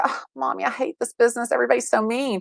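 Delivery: high, soft little whine to mom; whiny complaint. Retold or imitated. Daughter phoning her mom after a bad deal, whiny.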